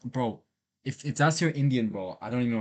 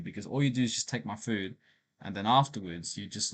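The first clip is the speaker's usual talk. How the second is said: usual speaking voice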